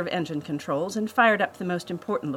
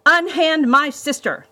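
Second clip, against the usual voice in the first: high, loud, drawn-out heroic challenge; defiant shout; 10 semitones higher, 8 dB louder than usual. A narrator's reading. Brother with crossbow confronts his sister's captor, defiant shout. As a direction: call it out boldly at the villain.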